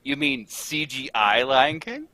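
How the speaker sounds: ordinary talk, nothing marked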